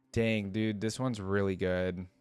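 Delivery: usual speaking voice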